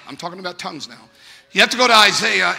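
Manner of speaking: ordinary talk, nothing marked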